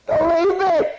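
put-on very high, loud child's wail; crying child voice. Toddler begging mama not to leave, wailing high. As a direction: plead like a scared toddler. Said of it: "That's a fervent appeal".

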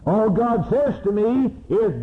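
All God says to me is pitched high and ringing; All God says to me is, cried out high. Shown as a demonstration. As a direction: lift the refrain to a peak. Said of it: "repeated rising refrain".

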